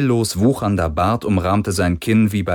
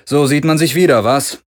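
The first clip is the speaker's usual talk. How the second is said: dry taunt; 7 semitones higher, 5 dB louder than usual. A narrator's reading. Galen taunts old rival.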